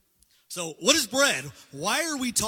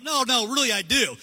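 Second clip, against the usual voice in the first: louder and higher, protesting; pleading insistence; 5 semitones higher, 8 dB louder than usual. Retold or imitated. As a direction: insist harder, voice rising. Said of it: "He's like".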